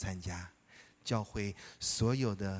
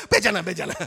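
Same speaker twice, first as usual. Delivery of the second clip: loud, high, laughing embarrassed protest; 8 semitones higher, 10 dB louder, faster than usual. Retold or imitated. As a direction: brush it off while laughing hard. Friend embarrassed, teased. (笑着大声推辞)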